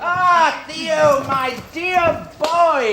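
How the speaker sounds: high, drawn-out delighted greeting; joyful welcome to the birthday boy. Acted. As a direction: greet him with open arms, pitch up.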